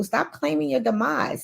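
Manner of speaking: usual speaking voice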